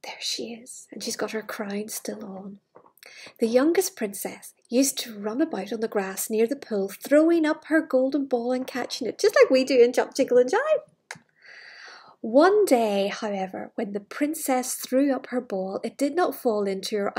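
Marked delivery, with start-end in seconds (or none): 3.4-11.2 louder, bright storybook read; lively story reading. Acted. Storyteller reading loudly to the children. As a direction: read it out big for the kids.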